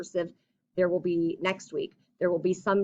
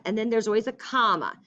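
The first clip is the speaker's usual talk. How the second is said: ordinary talk, nothing marked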